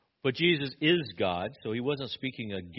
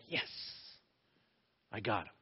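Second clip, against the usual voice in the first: hushed, gloating aside; secret celebration; 3 semitones lower, 10 dB quieter than usual. Retold or imitated. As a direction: celebrate privately under the upset act, softly. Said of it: "in celebration".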